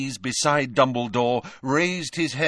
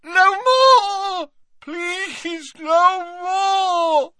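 very high agonized wail, loud and drawn out; Dumbledore yelling; 6 dB louder, much slower than usual. A narrator's reading. Dumbledore with insides on fire, agonized yell. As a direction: cry out as if burning inside. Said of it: "he yelled again as though his insides were on fire".

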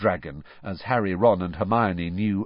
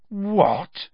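high-pitched, incredulous question; astonished "what"; disbelieving; 11 semitones higher, 3 dB louder than usual. A narrator's reading. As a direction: ask it in utter disbelief. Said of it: "said Ron in disbelief".

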